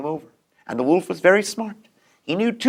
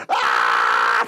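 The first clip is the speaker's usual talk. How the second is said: deep charging roar; low charging yell. Acted. Wolf charging at the door, deep yell.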